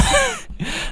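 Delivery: short high laugh; a quick squeaky laugh. Real, not acted. Laughs before continuing.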